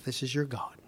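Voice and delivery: a low, hushed whisper. Acted. Preacher echoes the people's false claim, whispered low. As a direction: breathe it out as a scornful secret.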